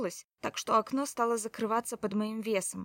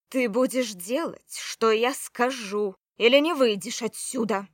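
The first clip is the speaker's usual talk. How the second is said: loud, slow, threatening order; commanding shout; 7 dB louder than usual. Acted. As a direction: shout the ultimatum. Commanding threat in magical duel. (грозный приказ)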